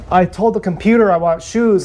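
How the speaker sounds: usual speaking voice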